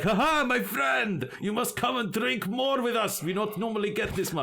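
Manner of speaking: high, hearty mock-Russian voice; Russian accent. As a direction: bellow a welcome in a hammed-up Russian accent. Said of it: "That's a Russian accent".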